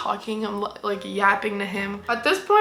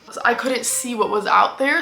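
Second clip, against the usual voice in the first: usual speaking voice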